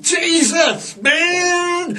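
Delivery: high shouted Jesus man; hammed-up awestruck stoner; stoned amazement. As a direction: call out in dazed delight. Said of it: "I said".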